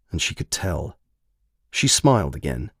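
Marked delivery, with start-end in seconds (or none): none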